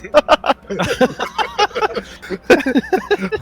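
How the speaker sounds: helpless high-pitched laughter; long high laugh. Real, not acted. Player cracking up over a pile of stress dice, laughing. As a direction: keep laughing, voice climbing high.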